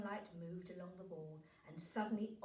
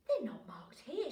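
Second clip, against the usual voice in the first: breathless, unvoiced gasp of surprise; a startled whispered gasp; 5 semitones higher, 5 dB louder than usual. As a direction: gasp it out in a hushed rush. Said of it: "she gasped".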